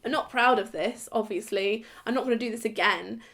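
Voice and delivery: normal talking voice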